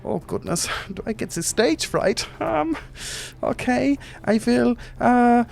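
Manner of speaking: squeaky little genie gushing over Titus; small Germanic genie. Acted. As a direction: play a tiny fussy genie, flustered. Genie flustered.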